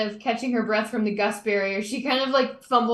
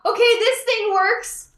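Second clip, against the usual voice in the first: high, loud, drawn-out cry; triumphant shout; 10 semitones higher, 6 dB louder, slower than usual. Acted. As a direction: shout it out high, stretching the words. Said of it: "And I showed up".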